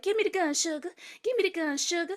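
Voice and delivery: high coaxing imitation; sweet-talking Reese. Imitating Reese sweet-talking Amy, lightly high coaxing. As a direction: coax her for the gun, sugar. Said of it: "Reese is like".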